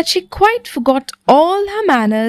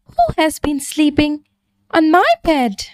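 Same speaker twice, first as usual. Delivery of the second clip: a bit higher, lightly voiced mother bear; mild grumble of a mother bear; Mother Bear grumbling over her bed, lightly higher; 6 semitones higher than usual. A narrator's reading. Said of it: "Mother Bear grumbled".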